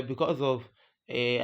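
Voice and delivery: normal talking voice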